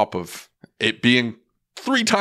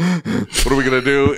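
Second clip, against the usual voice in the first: retold panic; 3 semitones higher than usual. Retelling panic after call.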